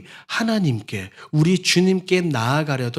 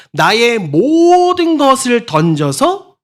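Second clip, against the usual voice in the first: loud, slow and higher, throwing everything in; straining cry; 7 semitones higher, 7 dB louder, slower than usual. Shown as a demonstration. Preacher acting out Bartimaeus's all-out cry, straining shout. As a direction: shout as if giving your all. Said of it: "악을 쓴다고 말하죠".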